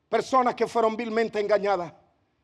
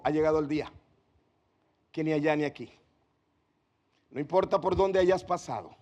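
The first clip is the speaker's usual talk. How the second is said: softer and lower, tender reassurance; gentle voice of Jesus; 4 semitones lower, 4 dB quieter than usual. Acted. Preacher voicing Jesus softly to the Samaritan woman. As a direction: speak the promise quietly, lovingly.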